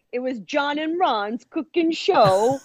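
high, slow mock show title; cooking show host. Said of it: "Hey, it's my cooking show".

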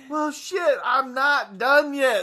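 mock frustration. Blow-up doll just popped, mock frustrated whine. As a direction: whine it through a laugh, cut short. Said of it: "You're like".

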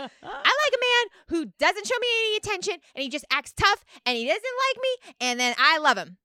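high-pitched put-on smitten woman; impression of reality-show castmate. Playing the castmate who loves the chase, high put-on gushing. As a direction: gush about him in a high character voice. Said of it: "That was a really good, you're getting so good at it".

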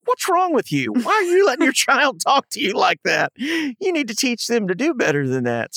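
high, indignant telling-off; exasperated mock outrage, laugh slipping in; mock scolding. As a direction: pretend to scold a lax parent. Imagined scolding of a parent.

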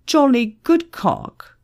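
slow, high, proud announcement of a name; swaggering, drawn-out introduction; cocky man. A narrator's reading. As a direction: savour your own name, drawing it out high. Arrogant man announces his silly name.

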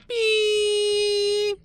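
long, high, sustained beep; piercing drawn-out beep noise; imitated beep. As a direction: hold a high, loud tone like ringing ears. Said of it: "all you can hear is a".

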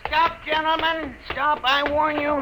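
high, urgent cry to stop; frantic warning. Acted. Inventor crying out to stop the detectives grabbing him. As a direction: warn them off in a panic.

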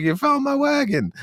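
very high falsetto joy; cartoonish overjoyed NPC; squeaky delighted NPC. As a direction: squeal it like a thrilled villager.

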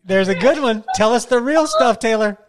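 loud, eager urging; excited egging on. Real, not acted. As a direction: hype her up to spill it. Host eggs guest on.

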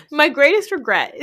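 breaking into real laughter mid-line; giggling through a confession; laughing. Real, not acted. Laughing through her own quote.

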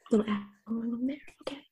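whisper. Real, not acted. Quiet aside while pouring drink.

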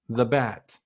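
ordinary talk, nothing marked

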